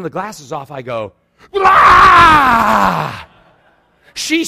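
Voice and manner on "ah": loud, high, drawn-out scare yell; full-volume comic "ah"; sudden scream. Retold or imitated. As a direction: burst out screaming to startle someone. He scares the girl.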